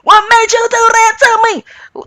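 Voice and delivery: high, loud repeated accusing shout; imitating yelling. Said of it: "she's yelling questions".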